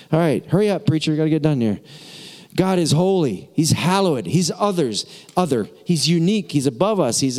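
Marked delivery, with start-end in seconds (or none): none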